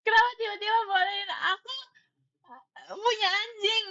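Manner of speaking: high, loud wail of disbelief; overwhelmed, hammed-up cry of astonishment; retold shock. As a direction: shout it as if near happy tears. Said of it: "aku, aku terkejut, sumpah".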